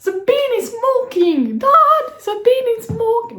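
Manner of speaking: put-on high, slow, drawn-out alarmed child; panicked kid quote. Kid telling dad the bin smokes, high and alarmed. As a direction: wail it like a kid calling his dad. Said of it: "C'est genre".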